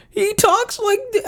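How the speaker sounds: very high-pitched cartoon-character impression; abandoned stab at a super high cartoon voice; high cartoon voice attempt. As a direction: squeak it way up high, mimicking a cartoonist's voice. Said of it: "you can't do the voice".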